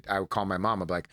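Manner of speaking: normal talking voice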